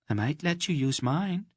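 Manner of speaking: Mady teasing the shy stranger, archly; arch tease. Acted. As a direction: dangle the offer with a sly lilt. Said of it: "looking at him archly".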